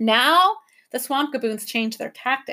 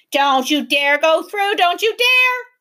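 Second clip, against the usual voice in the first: loud, rising bawl, much higher at the end; 7 semitones higher, 6 dB louder, slower than usual. A narrator's reading. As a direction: bawl it at them. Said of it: "they bawled".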